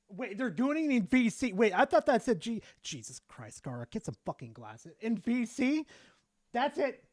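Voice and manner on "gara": ordinary talk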